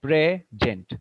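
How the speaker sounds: "Present" as two parts, PRE-jent; stress on first syllable. Shown as a demonstration. Teacher showing the noun form, first-syllable stress on "Present". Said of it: "the stress will be on the first syllable".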